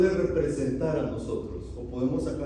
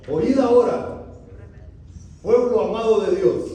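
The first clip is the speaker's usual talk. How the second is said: Moses scolding the people, louder; mock scolding shout; 8 semitones higher, 10 dB louder than usual. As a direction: bark it out like an angry prophet. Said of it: "¿Cómo dice la versión de ustedes?". (Moisés regañando al pueblo, en voz alta)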